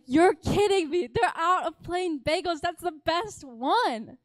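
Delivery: dramatic indignation at the news; outraged disbelief. Retold or imitated. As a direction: fume over the sold-out bagels, hammed-up. Bagels sold out, she fumes.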